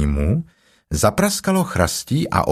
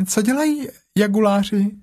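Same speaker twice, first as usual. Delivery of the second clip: much higher, small timid Piglet; little Piglet voice; 11 semitones higher than usual. A narrator's reading. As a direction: squeak it like a tiny pig. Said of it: "zeptalo se prasátko". (vysoký ustrašený hlásek prasátka)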